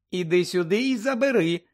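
high, taunting sing-song; gloating mermaid. Acted. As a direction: tease him, holding it just out of reach. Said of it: "зловтішалася русалка".

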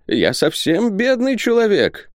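very high, drawn-out whine; whining. A narrator's reading. Isaac pleading poverty to the visitors, whining. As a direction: wail slowly like a poor old man. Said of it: "начинается нытьё".

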